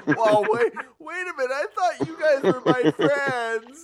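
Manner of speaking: high, laughing fake outrage; mock offended. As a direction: play wounded, laughing. Mock betrayal by friends.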